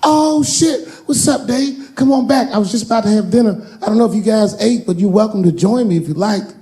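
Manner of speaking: high, excited buddy greeting; Kevin Hart. Retold or imitated. A star inviting Dave to dinner, high and excited. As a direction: welcome a friend high and eager. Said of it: "Kevin came around the corner".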